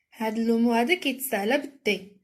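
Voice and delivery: ordinary talk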